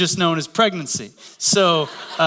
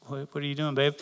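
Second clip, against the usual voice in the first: much softer, retold husband's murmur; hushed aside; 3 semitones lower, 8 dB quieter than usual. Husband questions wife beside the drive-thru microphone, hushed aside. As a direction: imitate a low-volume private question to your partner.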